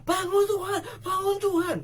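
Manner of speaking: high, loud, crying plea; desperate tearful wake-up call; panicked cry. Acted. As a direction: wail it like someone drowning. Disciples' panicked cry voiced.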